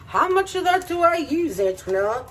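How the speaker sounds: high, puzzled mock question; high quizzical viewer. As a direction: ask it like a confused viewer. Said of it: "'cause I know you guys are like".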